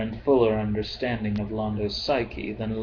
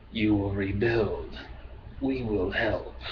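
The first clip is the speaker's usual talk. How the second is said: slow, measured alien voice; lightly voiced, drawn-out sibilant menace; a hint of slow hissing creature; low sibilant voice; 3 dB quieter than usual. A narrator's reading. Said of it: "spoke in a low, sibilant tone".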